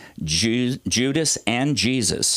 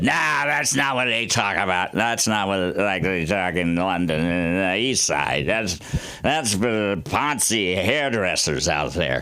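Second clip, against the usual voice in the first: put-on neighbour's breezy Cockney accent; correcting him in Cockney; a Londoner's Cockney brush-off. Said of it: "she told me how to do a Cockney accent".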